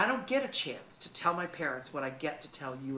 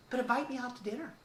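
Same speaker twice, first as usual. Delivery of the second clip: ordinary talk, nothing marked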